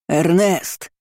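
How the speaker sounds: restrained call for a servant. A narrator's reading. Uncle summoning the big servant, calling out. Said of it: "крикнул он".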